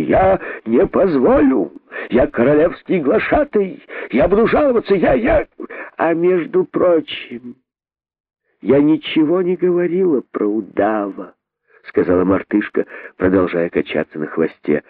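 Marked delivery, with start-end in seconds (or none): 0.0-5.5 high, spluttering indignant outcry; pompous herald working into hysterics; losing self-control. Acted. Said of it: "закричал находчивый, теряя самообладание". 6.0-11.3 higher, slow teasing monkey voice; unhurried, sing-song provocation; needling aside. Acted. Monkey needles the outraged herald.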